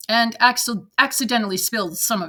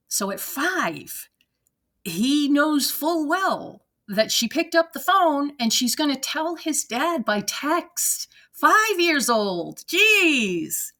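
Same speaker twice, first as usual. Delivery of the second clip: higher, drawn-out disbelief; incredulous; 5 semitones higher, slower than usual. Real, not acted. Commenter marvels at what a five-year-old knows, incredulous. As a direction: stretch it out in amazement.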